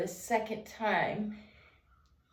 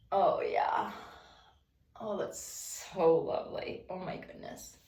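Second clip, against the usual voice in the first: soft whispered sighs of pleasure; whispered delight; 3 dB quieter than usual. Real, not acted. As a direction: murmur it in a whisper as the stretch lands. Neck roll, whispered pleasure.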